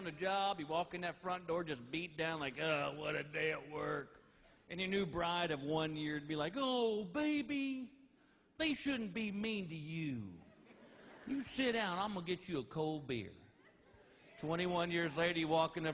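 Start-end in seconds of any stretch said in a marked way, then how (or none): 2.6-4.1 slow, softer beat-down groan; tired husband sighing; weary sigh. Retold or imitated. Tired husband coming home. 6.6-13.3 slow, softer cooing new bride; new bride soothing her tired husband, sweet and slow; mock sweet bride.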